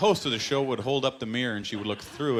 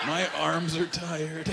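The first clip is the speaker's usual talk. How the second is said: slow, slurred mock drunk complaint; drunk boyfriend whine; 5 semitones higher, much slower than usual. Comic imitating a whiskey drunk boyfriend. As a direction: drag it out like a tired drunk.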